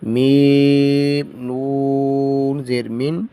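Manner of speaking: slow, measured letter-by-letter recitation; slow spelling drill. Shown as a demonstration. Teacher drilling students in spelling, slow recitation. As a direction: chant each letter and vowel slowly.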